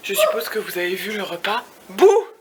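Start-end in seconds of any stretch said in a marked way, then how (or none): 1.9-2.3 playful boo. Pretend. Jump-scare at the hotel room.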